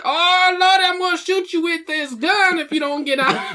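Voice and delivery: very high, slow falsetto big mama; hammed-up Southern mama with a gun, up in falsetto; slow, sky-high mock church mother. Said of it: "Big Mama".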